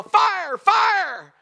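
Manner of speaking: high, full-out alarm shout; very loud, high-pitched emergency cry; yelling fire. Acted. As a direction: yell it as if to clear a crowded room. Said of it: "was to yell".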